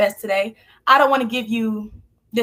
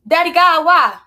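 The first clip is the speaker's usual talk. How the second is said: pleading cry to God; 6 semitones higher, 5 dB louder than usual. Retold or imitated. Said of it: "I call God Daddy God a lot".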